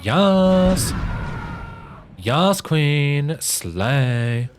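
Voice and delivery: high, loud, drawn-out "yas queen" cheer; hammed-up hype squeal with long swooping vowels; slay voice acting. Streamer asked by viewer to do a slay, hammed-up high cheer. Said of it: "do a slay with your great voice acting".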